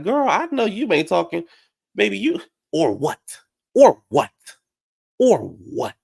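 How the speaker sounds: high-pitched mock challenge, punching "or what"; confrontational retort. Sutton to Erica in an argument, imitated high confrontational retort. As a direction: imitate an indignant comeback.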